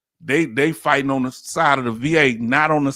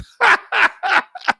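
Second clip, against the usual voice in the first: sudden high laugh, real amusement; high burst of laughter; 5 dB louder than usual. Real, not acted. Laughing at the scandal.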